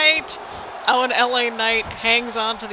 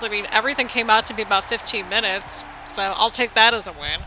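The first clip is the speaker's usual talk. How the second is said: usual speaking voice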